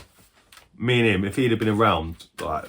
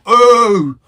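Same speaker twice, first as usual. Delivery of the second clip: a high, loud gasp of delight; real thrill at the next game; excited; 16 dB louder than usual. Real, not acted. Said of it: "I'm getting excited".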